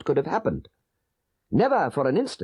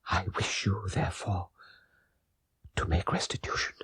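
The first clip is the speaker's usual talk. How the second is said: fading dying confession; 7 semitones lower than usual. A narrator's reading. Dying father begging his sons to make amends, fading. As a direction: let the voice give out bit by bit.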